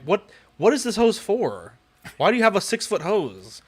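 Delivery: high, loud baffled questioning; exasperated disbelief. Retold or imitated. Guy retelling his bafflement at the six-foot hose, exasperated. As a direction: question it as if it is absurd.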